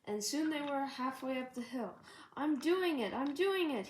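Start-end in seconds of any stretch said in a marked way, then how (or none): 2.3-3.9 mild panting. A narrator's reading. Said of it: "he panted".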